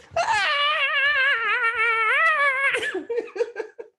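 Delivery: long, high, loud mock scream; girlish scream. Storyteller imitates hollering out the window, girlish scream. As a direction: holler like a girl, drawn out and comic. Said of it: "I'm hollering like a girl".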